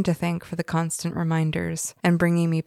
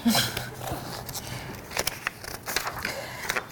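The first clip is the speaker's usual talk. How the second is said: quiet breathy nervous chuckle; nervous laugh; 4 semitones higher, 9 dB quieter than usual. Real, not acted. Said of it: "You can probably hear my nervous laughter".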